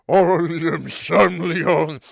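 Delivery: loud, slow, giant echoing parody; hammed-up giant imitation; booming mock Shamleon. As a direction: boom it out like a giant mocking him. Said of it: "I grew twenty feet tall to repeat it".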